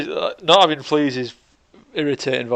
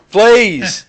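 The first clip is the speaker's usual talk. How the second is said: loud shouted plea, higher; shouting; 9 semitones higher, 11 dB louder than usual. Acted. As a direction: yell it out to be heard. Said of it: "Just shout louder".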